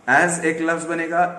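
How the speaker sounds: usual speaking voice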